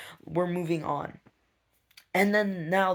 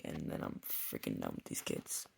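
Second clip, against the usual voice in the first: weary whisper; 12 dB quieter than usual. Real, not acted. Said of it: "tired venting at night".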